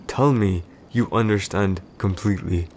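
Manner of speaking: normal talking voice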